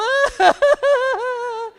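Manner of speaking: high, loud mock wail breaking into laughs; exaggerated wailing laugh; crying turned laughter. Shown as a demonstration. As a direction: fake a sob that becomes a laugh. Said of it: "if you find yourself crying, just go".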